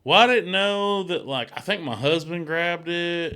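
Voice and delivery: high, loud, hammed-up innocence; mock innocent mom. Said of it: "the fucking mom's just, like, selling it".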